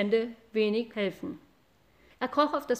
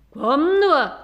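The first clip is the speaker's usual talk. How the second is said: loud angry cow dare; the angriest cow daring him; furious cow; 3 semitones higher, 9 dB louder than usual. Acted. Eldest cow dares the boy, loud furious voice. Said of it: "am zornigsten".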